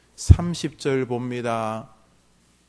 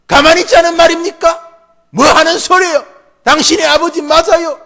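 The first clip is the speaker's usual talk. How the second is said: acted defiance, bursting into high, loud yelling; sons' outraged shouting; 12 semitones higher, 13 dB louder than usual. Sons confronting their father over their sister, high furious shouting. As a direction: start near normal, then explode at your father. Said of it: "자식들의 버럭 소리 지르는".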